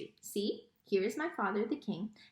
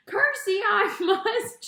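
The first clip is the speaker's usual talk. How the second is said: high, slow, laughing shout; 10 semitones higher, 8 dB louder, slower than usual. A narrator's reading. Mig shouts at the princess, laughing. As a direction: blurts it out loud, breaking into laughter. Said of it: "shouted Mig".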